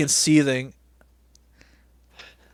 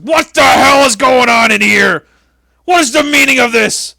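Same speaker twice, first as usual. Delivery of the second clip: furious booming boss yell, much higher and louder; mock Vince McMahon; 9 semitones higher, 10 dB louder than usual. Imitating Vince yelling at wrestlers in his office, furious. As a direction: roar like an outraged boss catching intruders. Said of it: "and then Vince walks in".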